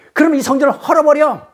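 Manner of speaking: high-pitched, emphatic challenge; acted forceful defiance. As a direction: fire back a dare, imitating a heated confrontation. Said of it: "예수도 그들에게 강력하게 대시는데". (강하게 맞서 대드는 흉내)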